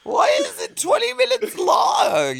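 very high shouted complaint; hammed-up shrill outburst; mock exasperated shriek. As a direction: screech it in fake torment. Mock complaint over long fart noise.

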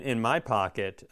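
ordinary talk, nothing marked

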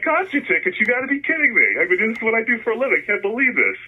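high, fast, excited disbelief; can't believe his luck, words tumbling out high; astonished. Retold or imitated. Teen learning the man sells concert tickets, astonished and excited.

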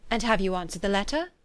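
plain reading voice

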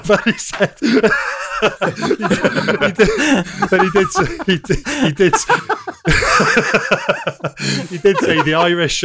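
laughing through the words; can barely get it out for laughing; giggling while retelling the coach. Real, not acted.

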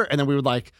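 normal talking voice

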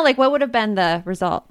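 usual speaking voice

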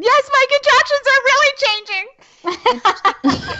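high, slow, giggling admission; sheepish laughing yes. Retold or imitated. Mother answers the midwife after the bloody show, high and laughing. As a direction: squeak it out through a laugh, drawn out. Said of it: "And I said".

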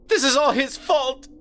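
high, loud grieving mother; screaming through tears. Acted. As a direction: accuse through sobs. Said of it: "screamed through tears".

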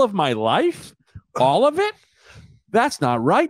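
high, protesting whine; mock indignant. Co-host protests apps taking his life, high mock indignation. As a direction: plead upward in exaggerated protest.

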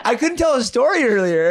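high giggly voice; laughing. Real, not acted. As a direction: giggle through the words. Giggly on mushrooms.

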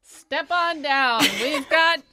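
slow, booming barker call; loud carnival barker. Acted. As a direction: draw the crowd in. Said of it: "you sound like a carnival barker".

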